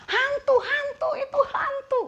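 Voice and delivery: high-pitched mock screams of terror; frightened screaming. Disciples screaming at the figure on the water, frightened screaming. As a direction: shriek it like the panicking disciples. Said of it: "berteriak-teriak karena mereka ketakutan".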